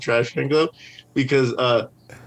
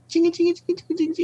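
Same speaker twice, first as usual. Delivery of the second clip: high chiming chingy noises, much higher; imitating a shaker can; much faster than usual. Mimicking his shaker can for the host, high jingling. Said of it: "it would sound like this".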